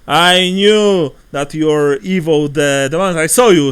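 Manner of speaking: loud, high furious accusation; accusing innkeeper. Acted. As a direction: spit out the accusation at the foreigner. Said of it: "says the innkeeper".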